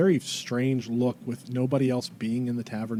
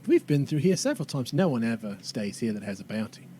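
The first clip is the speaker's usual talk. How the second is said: character voice drifting into Australian; Australian woman explaining matter-of-factly; accidental Australian accent. Acted. Tracy explaining the bounty hunt, slipping into Australian accent. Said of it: "God damn, she's Australian".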